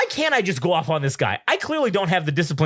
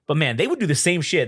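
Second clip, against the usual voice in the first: ordinary talk, nothing marked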